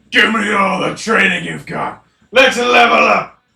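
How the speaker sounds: loud, pumped-up and ready; eager shout. Real, not acted. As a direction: hype yourself up for training. Player eager for training.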